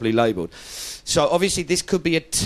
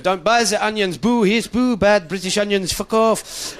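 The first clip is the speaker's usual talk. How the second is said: mock French protester; 7 semitones higher than usual. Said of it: "You'll have the French going".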